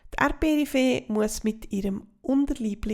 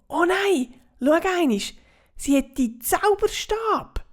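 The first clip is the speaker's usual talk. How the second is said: alarmed; 8 semitones higher, 7 dB louder than usual. Acted. Bibo alarmed at wand.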